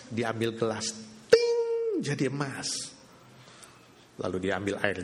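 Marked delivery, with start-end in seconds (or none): 1.3-1.6 high loud chime; sharp bell ping; magic ting. Acted. Glass turning to gold with a loud chime.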